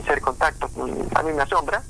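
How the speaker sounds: ordinary delivery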